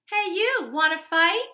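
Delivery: loud, high dare; ladybug voice. Acted. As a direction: shout up at the whale. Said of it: "the grouchy ladybug said".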